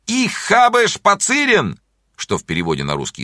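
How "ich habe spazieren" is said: very high, drawn-out wild yell; wild cry. A narrator's reading. Pupil's war cry before German lesson, wild high shout. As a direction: cry it out like a schoolkid bolting from class. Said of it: "дикий крик".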